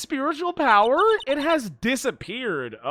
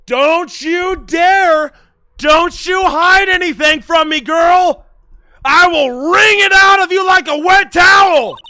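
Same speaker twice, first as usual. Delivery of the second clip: higher, louder, dragged-out furious yell; furious shout; 7 semitones higher, 10 dB louder than usual. Acted. Interrogator screaming at the girl to stop hiding things, furious shout. Voicing game character confrontation.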